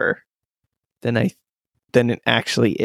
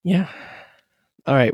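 ordinary talk, nothing marked

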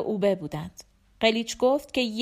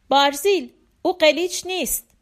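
loud, higher-pitched urgent plea; urgent appeal; 5 semitones higher, 5 dB louder than usual. A narrator's reading. Woman stops armed Barzil, urgent appeal. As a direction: call out to stop him, voice raised.

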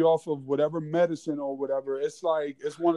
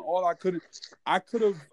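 ordinary delivery

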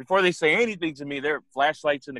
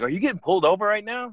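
lightly voiced ex, pitched higher; imitated ex-girlfriend; 7 semitones higher than usual. Ex asks during a police stop, imitated higher voice. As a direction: raise the pitch a little for her question. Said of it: "She was like".

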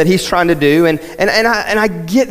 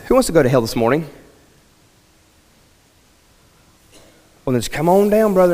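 mock altar call; faster than usual. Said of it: "see how ridiculous that is".

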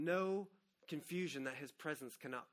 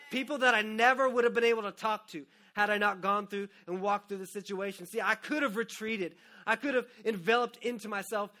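loud, higher, driving sermon; fervent preaching; 5 semitones higher, 9 dB louder than usual. Acted. Pastor urging the congregation to worship, loud and fervent. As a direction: preach it with rising fire.

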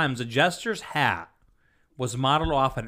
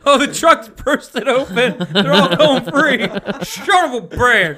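high laughing exclamations; 11 semitones higher, 8 dB louder than usual. Real, not acted. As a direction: laugh the words out. Laughing through video commentary.

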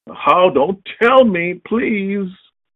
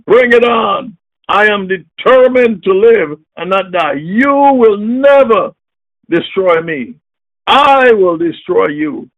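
preaching at full force; 6 semitones higher, 5 dB louder than usual. Acted. Preacher defying the devil before his congregation, full-force preaching. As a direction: thunder it at the devil like a battle cry.

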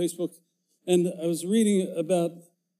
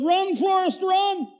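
high, loud yell after a runner; shouted Run Forrest; 3 dB louder, slower than usual. Retold or imitated. Joke narrator yelling after the thief, loud high shout. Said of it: "so I just yelled".

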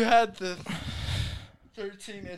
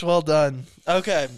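ordinary delivery

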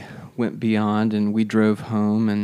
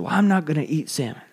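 put-on fast, higher stubborn kid voice; sulky refusal; 6 semitones higher, faster than usual. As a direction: dig your heels in like a pouty child. Son refusing salmon again.

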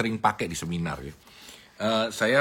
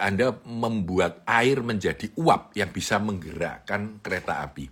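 normal talking voice

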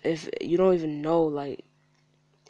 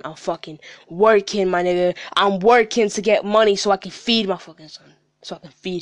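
loud, higher, emphatic frustration; real angry outburst; 4 semitones higher, 12 dB louder than usual. Real, not acted. Father defending working to feed his son, angry and loud. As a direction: raise your voice hard, hammering out the point. Venting at people prying into his life.

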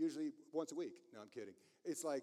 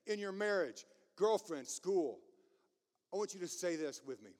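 ordinary delivery

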